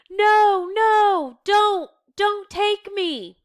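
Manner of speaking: high, loud terrified cries in sleep; nightmare screaming. Acted. Anne crying out in her sleep, terrified and high. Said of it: "she moans, tossing and crying in her sleep".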